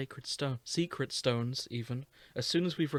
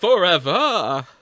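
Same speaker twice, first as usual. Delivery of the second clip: booming shout; 4 semitones higher, 14 dB louder, slower than usual. Acted. Mock heroic shout.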